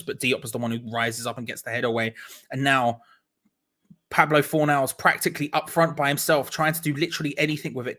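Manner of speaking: ordinary delivery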